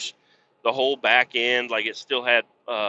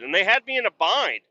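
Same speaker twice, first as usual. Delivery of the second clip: ordinary talk, nothing marked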